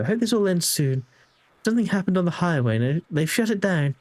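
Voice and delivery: sweet wife voice. Acted. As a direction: chat like a caring wife checking in.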